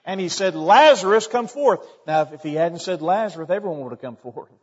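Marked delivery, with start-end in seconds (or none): 0.5-1.8 loud, high call of Lazarus' name; commanding cry toward the tomb. Retold or imitated. Jesus calls out at the tomb.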